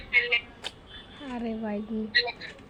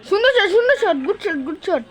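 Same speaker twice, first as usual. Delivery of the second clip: high, loud cheering for a great shot; excited cheer; 9 semitones higher, 9 dB louder than usual. Real, not acted. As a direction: yell it out in delight.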